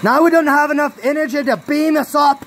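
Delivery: mock captain's lament.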